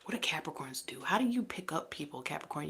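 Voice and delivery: soft, voiceless whisper. Real, not acted. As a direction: lean in and whisper it like a secret. Hushed aside wondering about Capricorns.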